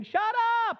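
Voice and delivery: very high-pitched mock scream. Preacher voices what congregants feel like screaming, mock scream. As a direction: act out a sudden scream. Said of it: "may feel like screaming".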